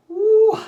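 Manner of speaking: sudden loud whoop, high; loud high woo. Real, not acted. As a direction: let out a sharp excited whoop. Exclamation mid-lesson.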